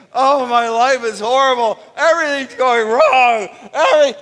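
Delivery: loud hammed-up complaining; mock lament, loud and laughing at the end; mock whining. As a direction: whine like a self-pitying crowd. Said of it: "his people are crying, saying".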